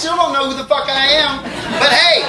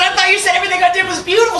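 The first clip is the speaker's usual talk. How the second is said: higher, fast, a hint of whiny kid; mock-hurt teen; 7 semitones higher, much faster than usual. Teen son protesting to his mom, lightly whiny. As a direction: rush out the wounded protest. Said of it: "I said".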